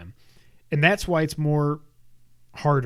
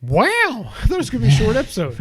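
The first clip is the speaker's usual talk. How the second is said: high, loud "wow" of surprise; surprised; 7 semitones higher, 6 dB louder than usual. Real, not acted. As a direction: caught off guard, voice jumping up. Notices how long they've been going.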